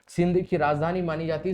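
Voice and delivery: ordinary talk, nothing marked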